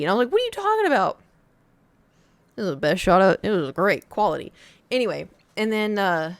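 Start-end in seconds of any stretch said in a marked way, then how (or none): 0.3-1.2 high, loud disbelieving squeal; incredulous. Retold or imitated. Teen narrator mocking the kid's complaint, high incredulity. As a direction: go way up in mock disbelief. Arguing with the shot kid.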